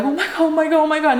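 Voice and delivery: high, panicky mock repetition; retold freaking out. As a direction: imitate a panicking kid up high. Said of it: "are just freaking out".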